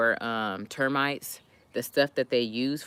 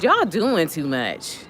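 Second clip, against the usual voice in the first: raised, drawn-out exasperated complaint; mock scolding; 5 semitones higher, 6 dB louder, slower than usual. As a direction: fuss at them with playful indignation. Scolding the ants in a story.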